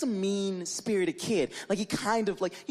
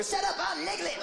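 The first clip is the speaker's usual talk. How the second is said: higher, brash retort; mock cartoon character; 11 semitones higher than usual. Niglet answering Pooh at the door, high and brash. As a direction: snap back cheekily. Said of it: "He's like".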